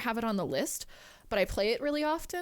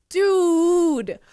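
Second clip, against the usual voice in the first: loud, drawn-out exclamation; amazed shout; 3 semitones higher, 10 dB louder than usual. Real, not acted. Streamer to Penosaurus after a big gift, amazed shout. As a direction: stretch the word out in real amazement.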